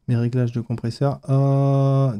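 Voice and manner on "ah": a loud sustained ah into the mic; loud test ah. Shown as a demonstration. Host testing the compressor level with a loud ah. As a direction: belt out a test ah. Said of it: "tu vois, on est à peu près".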